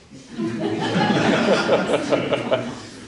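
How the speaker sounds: laugh. Real, not acted. Narrator laughs.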